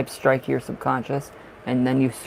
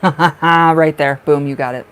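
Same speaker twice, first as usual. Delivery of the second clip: laughing, much louder triumph; triumphant; 10 dB louder, slower than usual. Real, not acted. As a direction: cheer them for nailing it. Celebrates viewer's correct guess.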